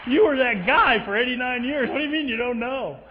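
can't believe what he just heard; loud, high, laughing disbelief; incredulous. Retold or imitated. Incredulous retort about his dad.

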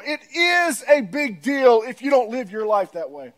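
usual speaking voice

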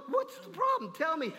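high, pleading question; pleading king. Acted. King coaxing his nervous advisors, high pitched. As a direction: coax the truth out of them.

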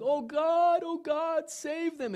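high, wailing plea, loud at first; crying out. Retold or imitated. Waking from the dream of destruction, crying out. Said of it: "breathing heavy and crying and going".

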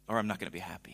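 breathy aside whispered to the room. Real, not acted. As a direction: lean in and whisper it like a secret. Aside to the congregation.

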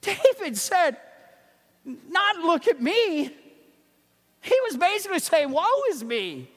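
high, loud fervent pulpit voice; fervent preaching. Acted. Pastor quoting David and Isaiah, fervent loud preaching. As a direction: cry the verses out to the congregation.

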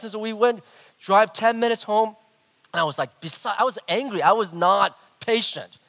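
ordinary delivery

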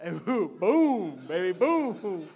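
drawn-out booming "boom", punched then falling; playful explosion. Pretend. Pastor showing church what dynamite does, playful booming.

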